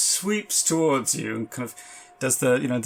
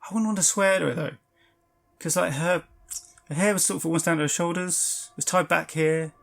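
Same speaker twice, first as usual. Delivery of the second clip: higher, hesitant bellboy voice; a nervous hotel bellboy, pitched up; 5 semitones higher than usual. Acted. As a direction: GM voicing the bellboy, unsure of the photo. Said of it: "He said".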